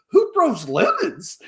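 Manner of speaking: high, slow, drawn-out mock disbelief; freaking out. Boss freaking out over lemon accusation, high drawn-out disbelief. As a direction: stretch it out in squealed incredulity. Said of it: "he's freaking out".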